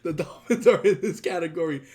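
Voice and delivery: slow, laughing through each word; can barely get it out for laughing. Real, not acted. Cracking up at Dolphins.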